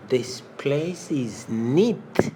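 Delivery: slow and drawn out; slow model phrase. Shown as a demonstration. Teacher slowly modeling the phrase for learners. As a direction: stretch each word for the class. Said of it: "slow model phrase".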